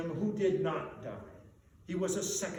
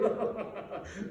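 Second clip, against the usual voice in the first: gleeful laugh; 3 semitones higher than usual. Acted. Scrooge overjoyed.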